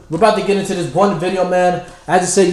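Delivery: usual speaking voice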